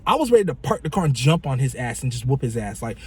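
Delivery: normal talking voice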